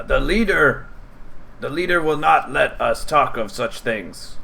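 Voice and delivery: high, wary goblin. Acted. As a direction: refuse firmly like a nervous goblin guard. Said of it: "And he says".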